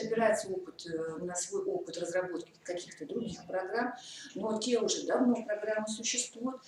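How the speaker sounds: ordinary talk, nothing marked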